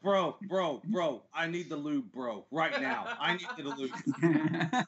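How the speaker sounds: low, urgent horny-bro impression; mock teenage boy. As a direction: plead low and needy like a desperate bro. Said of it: "fourteen-year-old boys jacking off".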